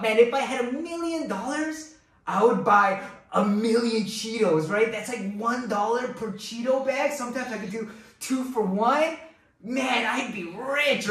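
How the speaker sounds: lightly voiced excited kid, high on man; a hint of a kid dreaming of candy money; childish daydream. Pastor voicing a child dreaming of a million dollars, mildly excited. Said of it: "as kids we think about".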